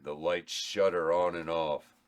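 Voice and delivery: ordinary talk, nothing marked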